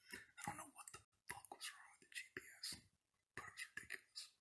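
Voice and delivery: sudden hushed whisper, fast. Real, not acted. Vlogger venting about his GPS, whispering fast. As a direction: drop to a quick breathless whisper.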